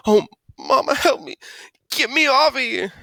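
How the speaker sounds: high, muffled wail for mom; panicked wailing. Acted. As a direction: cry out with lips stuck. Stuck Byron cries for help.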